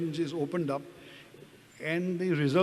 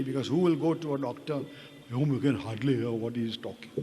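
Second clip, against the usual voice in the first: normal talking voice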